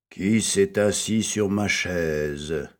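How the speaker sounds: a lightly deepened bear dad; Papa Bear. A narrator's reading. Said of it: "demanda Papa Ours". (voix grave de Papa Ours, légère)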